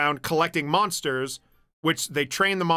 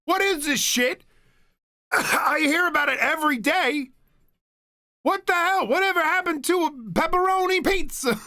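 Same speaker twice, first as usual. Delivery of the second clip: much higher mock rant; a fed-up crowd member venting; ranting heckler; 11 semitones higher than usual. Same heckler ranting at the gabagool talk, high mock voice.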